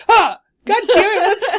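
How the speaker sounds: imitated startled yell, high and loud; mock a woken sleeper's shriek, close to laughing; startled outburst. Daughter imitating mom waking from her nap, startled yell. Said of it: "wakes up from her nap and goes".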